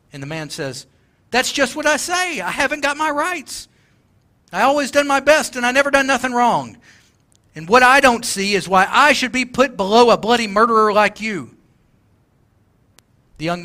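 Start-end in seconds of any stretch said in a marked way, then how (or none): 1.3-11.6 higher and louder, aggrieved protest; indignant ghost. Acted. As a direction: push back hard, feeling cheated. Ghost insists, offended.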